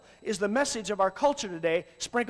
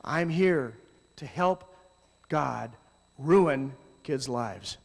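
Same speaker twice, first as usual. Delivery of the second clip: ironic slow statement; 5 semitones lower, much slower than usual. Pretend. Ironic slow mock confession.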